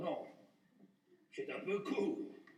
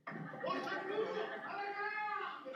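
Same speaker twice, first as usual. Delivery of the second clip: mock cry for help; 9 semitones higher, slower than usual.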